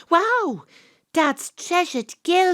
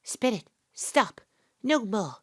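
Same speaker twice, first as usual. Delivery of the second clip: ordinary delivery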